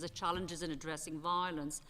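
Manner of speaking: normal talking voice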